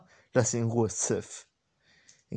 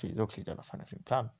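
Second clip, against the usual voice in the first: ordinary delivery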